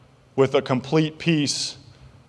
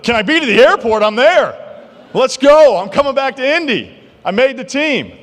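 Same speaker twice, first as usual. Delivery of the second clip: much higher and louder, bursting with joy; thrilled; 10 semitones higher, 5 dB louder than usual. Retold or imitated. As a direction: shout it like you just got the call. Thrilled reply to Colts call.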